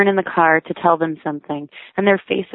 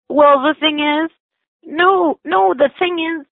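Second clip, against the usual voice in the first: very high, louder stammering rage; indignant crescendo; 12 semitones higher, 3 dB louder, slower than usual. Retold or imitated. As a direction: keep stammering, rising in outrage. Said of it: "stammering crescendo of indignation".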